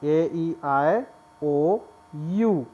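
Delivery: ओ, यू drawn out slowly; reciting vowels slowly; slow listing. Shown as a demonstration. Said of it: "मैं दोबारा draw करता हूँ".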